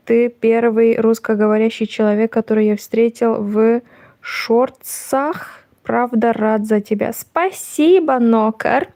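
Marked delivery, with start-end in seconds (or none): none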